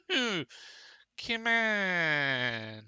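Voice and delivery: loud, high no and come on; frustrated shout. Real, not acted. Player yelling at the game as the bridge fails again. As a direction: yell at the game in real frustration.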